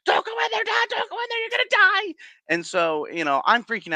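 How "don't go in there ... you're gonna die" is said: put-on much higher, pleading childlike panic; mock terrified kid. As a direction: beg him to stop, squeaking. Child begs dad not to go.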